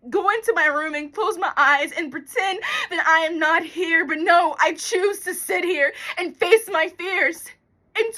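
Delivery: high, loud, near-tearful outpouring; worked-up, frightened rant; distraught. Acted. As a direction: force it out through the panic. Fights panic, facing fears in monologue.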